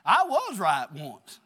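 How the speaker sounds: loud, slow punch on right; loud wry boast, slowed right down; emphatic aside. Real, not acted. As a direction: hammer it home, drawn out. Wry aside mid-story.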